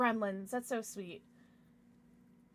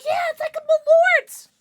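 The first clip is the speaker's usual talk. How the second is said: high, very loud burst of hype; excited shout; 16 dB louder than usual. Real, not acted. Streamer reacting to a viewer's message, excited high shout. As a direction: shout it out, pitched way up.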